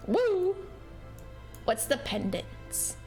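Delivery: normal talking voice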